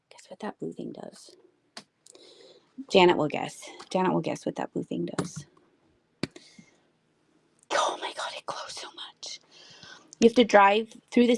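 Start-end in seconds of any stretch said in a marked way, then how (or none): 0.1-1.3 much softer, almost to herself; softer repeat. Shown as a demonstration. Streamer repeats her teaser to viewers under her breath. 7.7-9.4 whispered in real wonder; awed whisper. Real, not acted. Streamer sees the figurine glow and whispers in awe.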